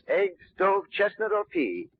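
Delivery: ordinary talk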